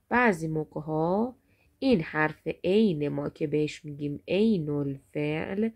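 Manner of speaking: ordinary talk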